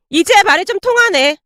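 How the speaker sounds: pleased and condescending; smug bully. A narrator's reading. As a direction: gloat at their submission. Bully is satisfied.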